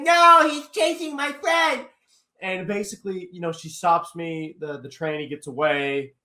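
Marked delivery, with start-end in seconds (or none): 0.0-1.9 high-pitched, drawn-out mock protest; imitated protester's wail. Girl protesting to the cops, high mock wail. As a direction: stretch it out high, mimicking her objection. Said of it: "And she's like".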